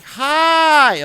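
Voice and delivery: high, loud mock yelled greeting; retold yell. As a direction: blast a cheery hi right in his ear. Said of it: "like, yelling right in my ear".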